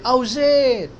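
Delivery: loud, high appeal How was it; cricket appeal shout. Retold or imitated. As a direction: call out like a bowler appealing. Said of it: "वो कहते हैं". (Cricket appeal जैसा ज़ोर से चिल्लाना)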